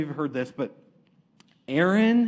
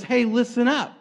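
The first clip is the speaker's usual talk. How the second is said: raised, emphatic rebuke; lightly acted divine scolding; God; 5 semitones higher, 4 dB louder than usual. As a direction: call them to attention, pitched up. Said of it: "God shows up and he says".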